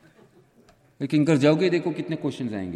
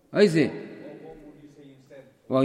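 ordinary talk, nothing marked

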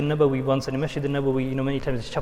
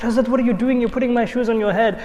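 higher, louder shocked protest; aghast disciple, a touch louder and higher; 6 semitones higher, 4 dB louder than usual. Retold or imitated. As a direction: blurt it out in retold alarm. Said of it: "he's shocked".

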